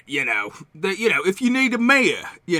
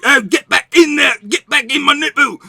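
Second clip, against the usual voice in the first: raised, high-pitched merchant scolding; scolding the centipede; 8 semitones higher, 3 dB louder than usual. Acted. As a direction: order a little pest back inside. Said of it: "And I'm like".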